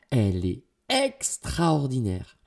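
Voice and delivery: slow, marvelling exclamation; delighted enthusiasm. A narrator's reading. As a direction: gush with wonder, stretching the word. Niece enthuses about the shirt.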